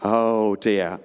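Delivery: ordinary delivery, nothing marked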